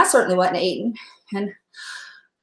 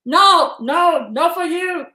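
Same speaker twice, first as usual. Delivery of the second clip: higher, drawn-out refusal in imitated Chinese accent; 6 semitones higher, slower than usual. Surgeon saying no to the patient, imitated Chinese accent. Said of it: "I do that accent".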